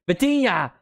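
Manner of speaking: loud, high burst on the player's name; excited name shout. Real, not acted. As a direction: yell it as the shot flies. Live call of a shot.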